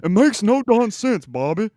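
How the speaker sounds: a hammed-up country dad scolding Bobby, slowly; Hank Hill impression. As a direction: friend tries a country-accented Hank Hill voice. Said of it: "Yeah, I can't do it".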